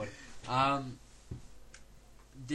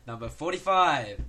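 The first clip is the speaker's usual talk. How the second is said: bingo caller; 9 dB louder than usual. Pretend. Call out those tricky numbers.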